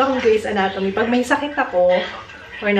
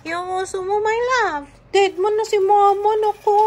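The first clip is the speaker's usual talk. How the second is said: mock sulk; 9 semitones higher than usual. Mama pretends to sulk at the baby, high mock whine. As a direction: tease the baby with a sulky whine.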